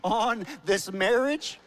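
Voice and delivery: hammed-up slow prayer for the marriage; slow mock plea. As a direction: beg heaven dramatically, slow and loud.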